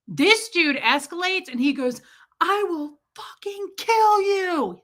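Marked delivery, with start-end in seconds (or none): 2.4-4.8 high, slow mock scream; imitated screaming threat. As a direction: stretch it out like a man screaming threats. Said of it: "screaming in broad daylight".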